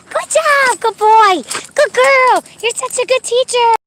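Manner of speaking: loud, very high cheering; excited dog praise. Real, not acted. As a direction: urge the dogs on, then squeal with delight. Urging and praising dogs.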